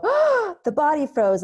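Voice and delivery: high, loud panicked cry of alarm; a client's shrill panic as the body freezes. Retold or imitated. Client panicking about a frozen body, high loud alarmed cry.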